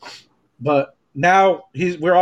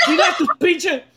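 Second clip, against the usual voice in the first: retold mom yelling; 8 semitones higher than usual. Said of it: "seriously starts yelling at him".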